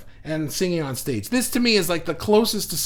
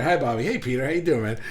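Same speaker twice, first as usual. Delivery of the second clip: ordinary talk, nothing marked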